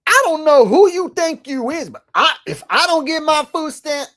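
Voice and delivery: high, loud mock outrage; over-the-top parody of indignant ranting; mocking impression. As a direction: shriek indignantly in a mocking put-on voice. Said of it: "mock it".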